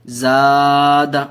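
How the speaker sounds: زاد with the aa held one alif long; teacher stresses the long vowel in زاد; drawn-out vowel. Shown as a demonstration. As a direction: hold the aa in زاد, then a quick d. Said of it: "ایک الف کے برابر کھینچ کر پڑھتے ہیں".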